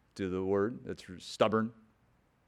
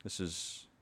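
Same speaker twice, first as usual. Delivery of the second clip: ordinary delivery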